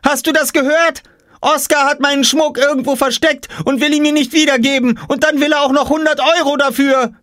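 very high outraged tattling; screaming. A narrator's reading. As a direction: shriek the complaint to Mum. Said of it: "schrie Jule". (empört kreischende, sehr hohe Mädchenstimme)

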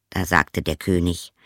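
plain reading voice

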